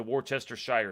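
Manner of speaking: Worcestershire with the ending "shyer"; local American pronunciation. Shown as a demonstration. Naming the sauce in the fridge, Worcestershire ending in "shyer". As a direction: rhyme the end of Worcestershire with fire. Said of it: "that's how we say it here".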